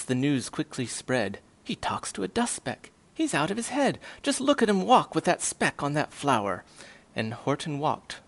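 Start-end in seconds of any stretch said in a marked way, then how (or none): 1.6-6.6 high, scandalized gossip voice; gossiping animals. Acted. Animals spread news of Horton, high gossipy voice. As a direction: pass it on like jungle tittle-tattle.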